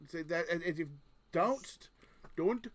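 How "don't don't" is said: Indian accent attempt. Shown as a demonstration. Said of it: "you become Indian".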